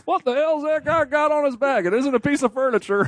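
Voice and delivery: high, startled onlooker voice; incredulous bystander. Pretend. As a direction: gawk at the guy with arms. Voicing a bystander.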